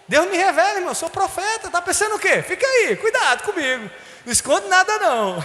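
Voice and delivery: high, playful boasting; mock prophet bragging. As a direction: tease the flock as an all-seeing seer. Jokingly plays the all-knowing prophet.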